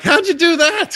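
high, incredulous mock question; baffled disbelief. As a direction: ask it like you can't believe it. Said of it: "Even the Falcons were like".

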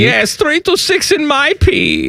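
high comic German greeting; Common Man's German accent. Pretend. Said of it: "Common Man's German accent".

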